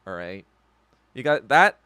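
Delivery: ordinary delivery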